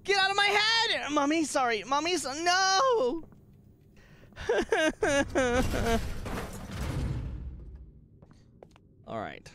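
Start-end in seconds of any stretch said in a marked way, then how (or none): 0.0-3.2 high-pitched, hammed-up yelling; mock-anguished shouting. Gamer shouting in mock torment. 4.4-7.8 high laugh trailing off. Real, not acted. Laughs after the outburst.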